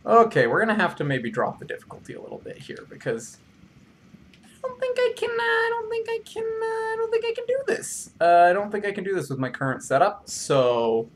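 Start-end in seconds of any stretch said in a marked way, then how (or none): 4.4-8.1 tiny high squeaky whine. Pretend. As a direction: whimper it in a little squeak. Squeaky voice before plain repeat.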